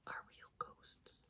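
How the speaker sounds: slow, hushed whisper; spooky whisper. Acted. Reader whispering to the children about tree decorations. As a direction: tease them with a secret.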